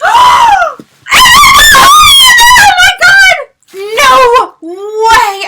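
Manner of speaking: real high, loud scream of disbelief; screaming excitement. Real, not acted. Fan seeing a pink bag in the box, screaming excitement. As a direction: shriek it, high and drawn out.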